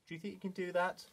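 ordinary talk

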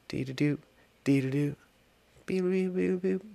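high, soft robot-like beeping; idle waiting sing-song. Pretend. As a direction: fill the wait with little high beeps. Idle noises while waiting for launch.

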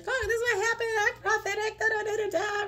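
mocking her younger gushing self; overexcited self. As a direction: gush it out like an eager show-off. Mimics her old excited self.

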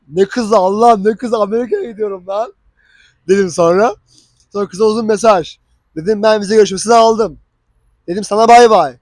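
usual speaking voice

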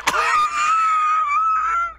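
long, loud, very high squeal of laughter; shrieking laugh. Real, not acted. Viewer shocked by the show, shrieking laugh. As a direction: burst into a real high-pitched shriek of laughter.

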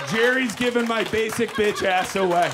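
ordinary delivery

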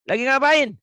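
put-on high, piercing little-kid call; child's voice. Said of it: "anak kecil". (suara anak kecil yang bertanya keras)

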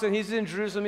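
usual speaking voice

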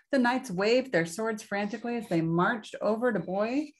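ordinary delivery, nothing marked

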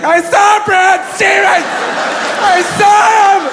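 very high, hammed-up screaming protest; old woman dragged away. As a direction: wail it out as you're hauled off. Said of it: "gonna get taken away".

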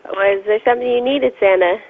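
ordinary talk

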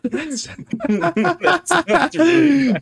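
very high, loud laughing outburst; helpless, squealing amusement; laughing hard. Real, not acted. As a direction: burst out laughing through the words. Cracking up at wiki listing.

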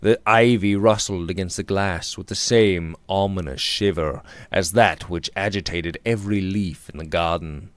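usual speaking voice

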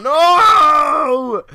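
one loud high scream of rage; real angry scream; furious shout. Real, not acted. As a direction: yell it out in pure frustration. Dies again, furious outburst.

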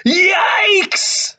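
high startled shriek; scared yelp. Acted. Burglar startled by the dog in the dark, high frightened yelp. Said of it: "said one of the burglars".

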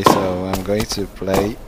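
ordinary talk, nothing marked

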